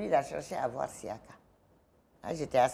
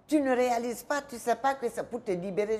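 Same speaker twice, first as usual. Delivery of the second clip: retold scolding; 7 semitones higher than usual. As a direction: snap at her like she's foolish. Guard scolding her, slapped her.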